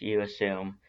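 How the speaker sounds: usual speaking voice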